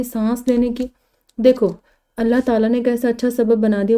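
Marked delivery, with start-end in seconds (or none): none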